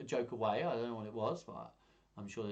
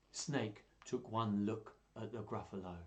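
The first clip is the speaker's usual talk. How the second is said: plain reading voice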